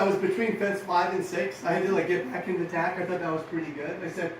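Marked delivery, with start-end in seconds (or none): none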